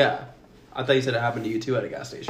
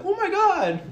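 high-pitched mock gasp of recognition; imitated fan amazement; slower than usual. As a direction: squeal it like a starstruck stranger, half laughing.

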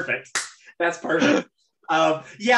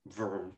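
low, soft power-down hum; robot powering down; 8 semitones lower, 15 dB quieter than usual. Retold or imitated. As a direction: imitate a machine quietly shutting off. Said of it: "like a robot, just shuts off".

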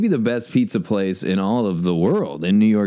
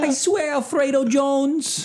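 pleading. Pretend. Wife pleads with mobster.

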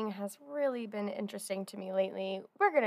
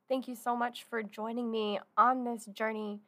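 normal talking voice

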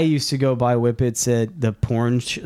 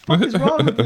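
high mock girlfriend snapping at him on the ground; imitating the girlfriend; 7 semitones higher, much faster than usual. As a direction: scold him from above, hammed up. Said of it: "She's like".

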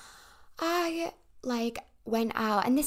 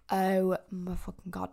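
slow, lower mock groan of regret; morning-after dread; 4 semitones lower than usual. Waking up after a drunken night, mock dread. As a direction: drag it out in mild mock horror.

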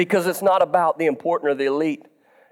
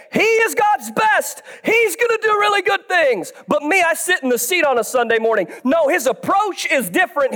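impassioned preaching; 6 semitones higher than usual. Acted. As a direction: drive each line home to the congregation. Preaches with force to congregation.

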